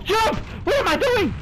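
high frantic yelp at the controller; scrambling, blurting in a panic; panicked yelp. Real, not acted. Gamer at his controller, a panicked high yelp. Panicked jump during gameplay.

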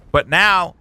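ordinary talk, nothing marked

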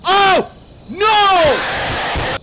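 high, loud drawn-out "no"; panicked scream. Real, not acted. Player caught by the animatronic, panicked scream. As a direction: scream it as you get caught.

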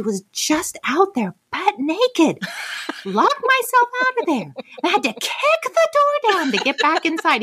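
high-pitched, exasperated complaint; retold indignant rant. Wife tells husband she was locked out, indignant rant.

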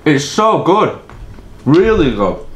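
chewing while talking; eating pie mid-sentence; mouth full of food. Real, not acted. Seen 2: Left man's lips purse and his jaw works between words, eating pie.

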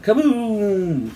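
high, loud falling boom; long drawn-out blast; imitated kaboom tailing off; bomb explosion. A narrator's reading.

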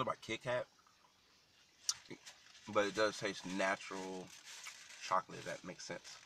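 talking between bites; chewing while talking. Real, not acted. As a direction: mouth partly full. Seen 2: hand brings food to mouth, then talks while chewing.

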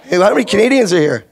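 ordinary delivery

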